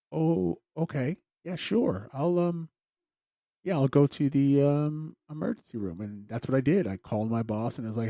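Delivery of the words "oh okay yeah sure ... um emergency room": stunned. Retold or imitated. Stunned by ER order.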